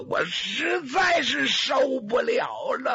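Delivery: high, slow sobbing cry of pain; wailing in agony, voice cracking high; crying plea. Acted. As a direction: break down begging for an end to the pain. Wounded man begs to be killed.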